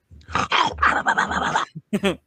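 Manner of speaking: breathy laugh. Real, not acted. Laughs after throwing chicken.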